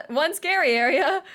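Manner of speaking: alarmed, with the pitch climbing; mock alarm. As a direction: echo it in playful disbelief.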